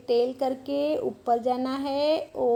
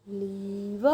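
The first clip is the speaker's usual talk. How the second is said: drawn out; 4 semitones lower, 6 dB quieter, much slower than usual. Shown as a demonstration. Sounding out while writing.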